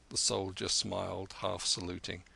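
ordinary delivery

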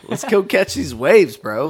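high, loud stoked surfer call; surfer dude. Pretend. Riffing with friends, loud high surfer bro. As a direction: yell it like you're paddling out.

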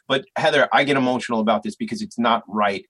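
ordinary talk

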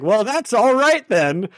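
sotaque americano imitado, mais agudo e lento; American accent. Retold or imitated. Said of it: "do his American accent".

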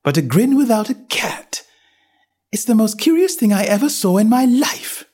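put-on young girl voice. A narrator's reading. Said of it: "thought Alice".

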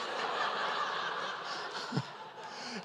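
soft wheezing laugh without voice; breathless silent laugh. Real, not acted. Preacher laughs at his wedding joke, silent wheeze. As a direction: laugh soundlessly at your own joke.

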